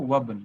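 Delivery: "वबन" said low as one joined word; linked reading. Shown as a demonstration. Teacher contrasting spelling with reading, "वबन" linked without i. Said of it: "लेकिन पढ़ेंगे कैसे इसको?".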